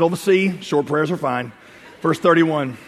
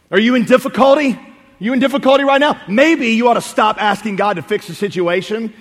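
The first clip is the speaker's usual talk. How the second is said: usual speaking voice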